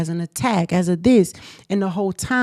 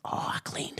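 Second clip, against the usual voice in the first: retold whisper; 14 dB quieter than usual. Retelling what she said about the room, whispered. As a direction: whisper it like a sheepish confession. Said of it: "I'm like".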